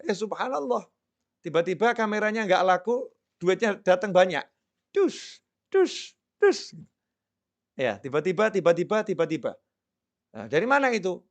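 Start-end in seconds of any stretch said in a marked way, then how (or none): 4.9-6.7 money thuds. Acted. Money pouring in suddenly.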